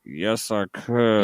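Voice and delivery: low, drawn-out answer; retold slow reply. C J agreeing to the host's request, low slow reply. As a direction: drop low and stretch it out.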